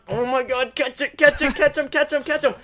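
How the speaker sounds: high, loud repeated pleading; frantic urging. Retold or imitated. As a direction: urge it on desperately. Retold urging while throwing.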